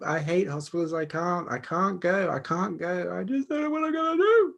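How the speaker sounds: frightened friend. Pretend. Friend phoning about a scary operation, frightened and pleading. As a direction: play the friend panicking about the hospital.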